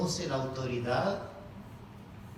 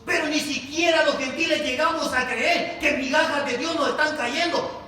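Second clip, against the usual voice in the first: loud, raised preacher's declaration; full-force preaching; 9 semitones higher, 8 dB louder than usual. Real, not acted. Preacher urging believers to trust in God's crumbs, full-force preaching. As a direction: drive each phrase home to the congregation.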